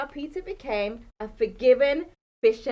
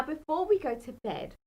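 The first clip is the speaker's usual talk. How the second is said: plain reading voice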